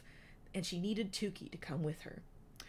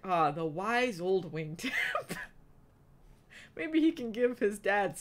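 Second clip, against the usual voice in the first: laughing; 3 semitones higher, 6 dB louder than usual. Real, not acted. Reader laughs at character.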